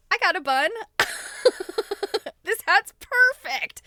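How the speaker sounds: light up as you show it off; excited. Real, not acted. Showing her bun to viewers, excited.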